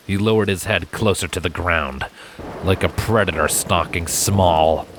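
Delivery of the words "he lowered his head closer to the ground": plain reading voice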